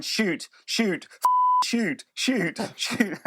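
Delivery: put-on high, squeaky Norwegian-accented coaching shout; Solskjaer's Norwegian accent. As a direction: imitate a Norwegian coach yelling at shooters. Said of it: "he's got this Manchester stroke Norwegian accent".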